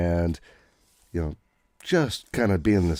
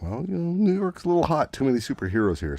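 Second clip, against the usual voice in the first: lightly voiced mob boss, pitched high; a hint of a smug gangster, airy; mobster voice; 11 semitones higher, faster than usual. Magia boss explains the move.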